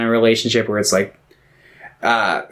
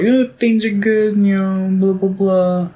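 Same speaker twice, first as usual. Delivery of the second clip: high, put-on earnest little voice; mock speech; 9 semitones higher than usual. Sam giving his famous speech, high mock earnestness. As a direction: rattle off a mock-heartfelt speech. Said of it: "he's like".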